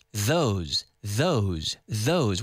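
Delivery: "those" with the tongue out on the th. Shown as a demonstration. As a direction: stick your tongue out for "those". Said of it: "With this word, those, you have to stick your tongue out".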